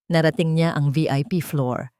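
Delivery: ordinary delivery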